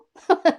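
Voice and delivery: loud laughing burst; burst of laughter. Real, not acted. Laughing out loud at her starstruck moment. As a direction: laugh out at your own story.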